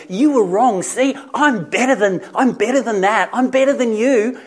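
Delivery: loud, high-pitched gloating; mock judgmental. Imagined accuser pouring judgment on Peter, loudly judgmental. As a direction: crow it loudly as a put-down.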